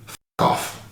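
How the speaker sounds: low muttered curse under the breath. Retold or imitated. [name] telling the driver to leave, hushed curse. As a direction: say it hushed and cold. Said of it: "I just go straight up to that car and I say".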